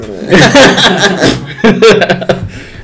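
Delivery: laughs at the memory, pitch up; laughing. Real, not acted.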